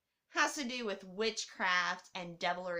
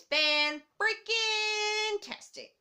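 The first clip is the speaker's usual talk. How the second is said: sing-song gushing; 10 semitones higher, 5 dB louder, much slower than usual. Real, not acted. Gushing about actor.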